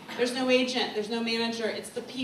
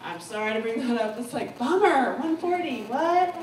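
ordinary delivery

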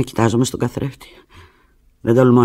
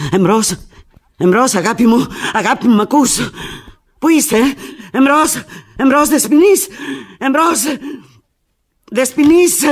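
high, loud calling into dead line; frantic calling; 12 semitones higher, 7 dB louder than usual. Acted. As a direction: shout for the operator in panic. Line cut, calling operator.

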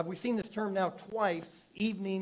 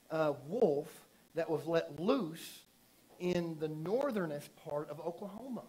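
ordinary talk

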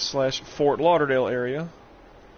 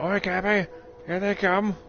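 put-on higher-pitched old seafarer hailing his captain; pirate sailor impression; 5 semitones higher, slower than usual. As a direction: call it out like a video-game pirate, drawing out the last words.

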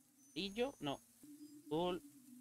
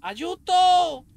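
high loud cry for help; being chased; 9 semitones higher, 8 dB louder than usual. Real, not acted. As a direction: wail for help while fleeing. Chased by the witch.

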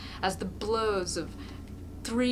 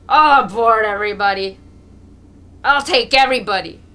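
put-on roaring drunk peasant bellowing, louder; drunken shout; 2 semitones higher, 16 dB louder than usual. A narrator's reading. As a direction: yell it to the whole street like a drunk. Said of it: "Mokolka shouts again".

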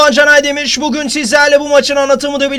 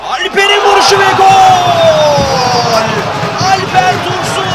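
screaming football commentator; 4 semitones higher, 5 dB louder than usual. Real, not acted. Goal call in live commentary.

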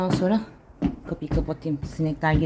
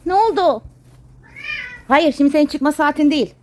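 firm scolding; 8 semitones higher, 7 dB louder than usual. Real, not acted. Scolding the cat.

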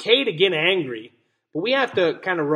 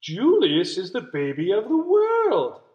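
sing-song, higher proud announcement; doting parents chiming; 4 semitones higher than usual. Acted. Parents praising baby Julius, sing-song and proud. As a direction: chime it like adoring parents. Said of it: "chimed Lily's parents".

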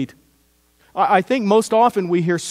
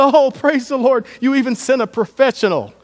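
mock joyful shout, pitched up and louder; joyful yell; 8 semitones higher, 5 dB louder than usual. Mother thanking the ex-con who opened her car, overjoyed yell. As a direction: belt out the thanks like a relieved mother. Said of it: "And she just yelled".

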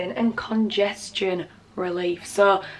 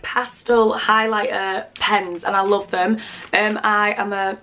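ordinary delivery

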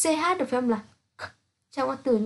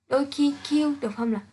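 ordinary delivery

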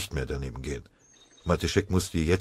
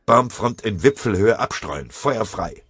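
louder fire order; barked command; 5 semitones higher, 6 dB louder than usual. A narrator's reading. Sergeant ordering machine gun fire.

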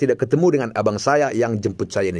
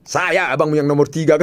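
laughing; 4 semitones higher than usual. Retold or imitated. Punchline quote of brother.

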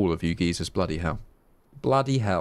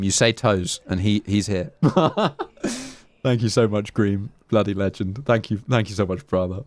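ordinary delivery

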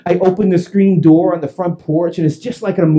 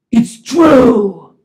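high, loud wail; anguished admission; 7 semitones higher, 6 dB louder than usual. Retold or imitated. Calvin admitting he reported weapons, anguished wail. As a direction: wail the confession.

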